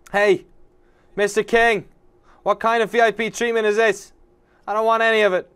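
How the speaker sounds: high, loud protest; indignant shout. Acted. Kidnapped character protesting to the king, indignant shout. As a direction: call out in outrage at someone unseen.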